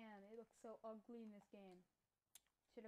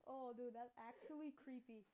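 ordinary talk, nothing marked

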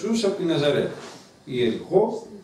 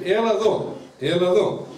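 firm summons, a little higher; commanding; 6 semitones higher than usual. Retold or imitated. Jesus ordering the blind man to come, commanding. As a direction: call him over like a command. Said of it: "με αυτό το ύφος".

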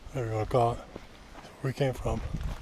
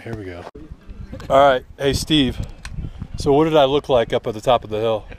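usual speaking voice

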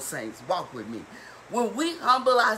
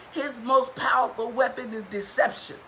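usual speaking voice

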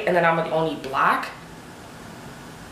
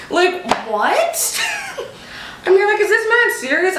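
high, laughing disbelief; disbelieving laugh; 13 semitones higher than usual. Real, not acted. Laughing in high disbelief about the boss.

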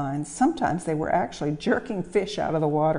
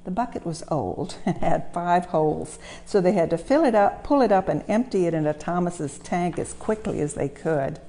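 ordinary delivery

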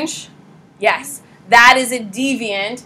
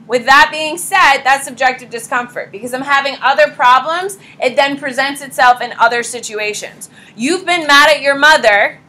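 ordinary delivery

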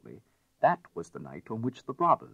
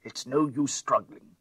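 straining detective; 4 semitones higher than usual. A narrator's reading. Detective pinning a thief.

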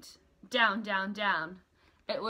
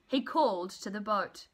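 plain reading voice

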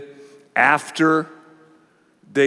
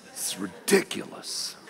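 whispered aside; 10 semitones lower, 12 dB quieter than usual. Real, not acted. Muttered aside at the board game.

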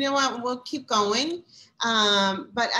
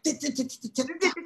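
rapid staccato "dit-dit-dit" glitch noise; imitated stutter of frozen audio; 5 semitones higher than usual. Host showing caller how the frozen line sounded, stuttering glitch imitation. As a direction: mimic a choppy, breaking-up connection. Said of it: "it was like".

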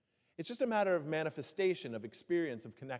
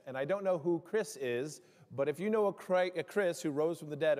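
ordinary delivery

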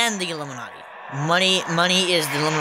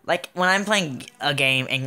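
ordinary talk

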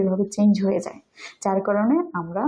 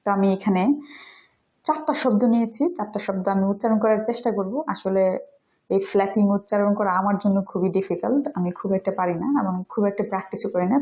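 usual speaking voice

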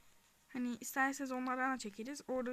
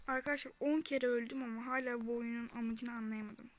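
normal talking voice

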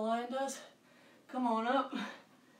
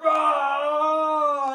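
loud higher roaring shout; giant lion roar; 5 semitones higher, 10 dB louder than usual. Shown as a demonstration. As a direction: roar big for the kids. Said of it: "Five big giant lion roars".

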